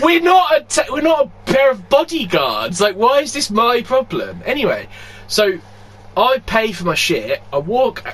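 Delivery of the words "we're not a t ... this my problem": high, loud, laughing near-shout; worked-up outburst, half laughing; exasperated shout. Retold or imitated. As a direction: protest with a cracking, laughing yell. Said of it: "I was like".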